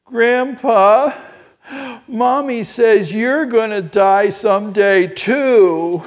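high, loud imitation of a tearful toddler; mock quivering child, slow and drawn out; tearful little boy. Small grandson asking grandpa about death, imitated near tears. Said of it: "he was kind of quivering. I thought he was gonna cry".

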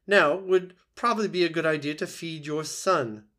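high, loud bossy goose voice; old goose. A narrator's reading. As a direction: prod the new mother like a squawky goose. Goose advising the robot.

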